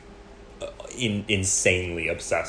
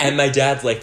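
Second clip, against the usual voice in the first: ordinary talk, nothing marked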